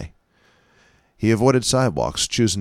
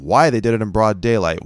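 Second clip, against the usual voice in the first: normal talking voice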